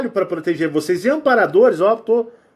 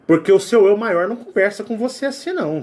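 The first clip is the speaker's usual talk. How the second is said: ordinary talk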